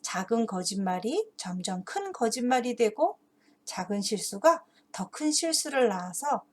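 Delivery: ordinary talk, nothing marked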